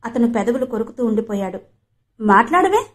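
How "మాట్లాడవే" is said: pressing for an answer, pitched higher; insisting. A narrator's reading. Renu pressing Vasu for a reply, insistent. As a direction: push him to speak up. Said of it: "రెట్టించింది Renu".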